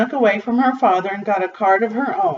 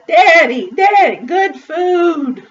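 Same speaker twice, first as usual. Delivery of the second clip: put-on higher, louder pleading child; eager child; 6 semitones higher, 3 dB louder, slower than usual. A narrator's reading. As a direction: call out eagerly to Daddy, pitched high. Child eagerly shows candy to father.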